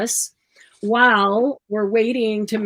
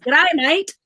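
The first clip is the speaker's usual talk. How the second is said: Australian accent; 4 semitones higher than usual. Shown as a demonstration. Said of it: "I need to practice that".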